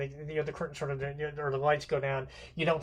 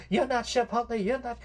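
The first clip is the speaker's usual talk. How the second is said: higher, louder, panicked denial; imitating Blanche as the illusion breaks; a frightened Southern belle protesting; distressed Blanche; 8 semitones higher, 5 dB louder than usual. Blanche realizes caller is wrong man.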